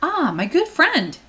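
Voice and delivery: high, slow, beaming hello; delighted greeting. Acted. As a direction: greet an old friend with joy.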